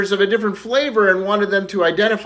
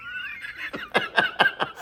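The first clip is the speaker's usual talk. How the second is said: long laugh; 9 dB quieter than usual. Real, not acted. Teller laughs at own punchline.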